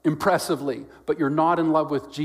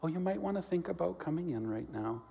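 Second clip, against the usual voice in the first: very soft, timid-sounding mock suggestion; mild suggestion; 3 semitones higher, 11 dB quieter than usual. Parent to child playing on a road, softly suggesting. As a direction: offer it gently, far too politely for danger. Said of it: "just offer a little suggestion to them".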